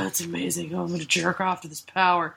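a hint of a leering bad guy, hushed and low; mocking creepy villain. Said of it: "And he's like".

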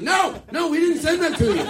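a hammed-up high-pitched no thanks; high mock rejection. Girls' imagined rejection.

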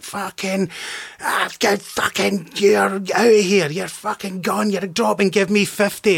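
high, hammed-up enraged yell; furious sergeant. Sergeant throwing the back-talking actor off set, high mock rage. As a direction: lose it completely at the recruit, imitating.

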